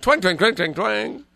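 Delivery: imitated banjo twangs. Host repeats the dressing-room banjo routine, high mimicked twangs. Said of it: "and a long pause, and".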